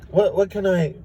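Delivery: high, eager customer-service voice; nice helpful teller. Retold or imitated. Teller lady asking Rick what he needs, high and helpful. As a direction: chirp out your offer to help. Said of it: "really nice and helpful".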